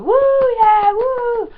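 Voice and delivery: high, loud whooping; concert cheering. Retold or imitated. Showing what you do when clapping at a gig, high loud cheer. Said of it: "when you clap, you go".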